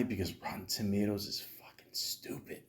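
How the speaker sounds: a sudden hushed whisper; whispered aside. Real, not acted. Vlogger muttering to viewers about Rotten Tomatoes, whispered. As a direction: drop to a conspiratorial whisper. Aside while ranking review sites.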